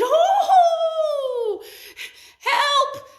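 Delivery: very high, loud cry for help; alarmed shout. A narrator's reading. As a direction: raise the alarm in a panic. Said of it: "and he said".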